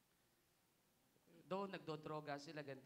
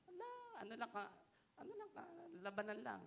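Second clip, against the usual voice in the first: mock high retort; 10 semitones higher, faster than usual. Mimicking careless retort.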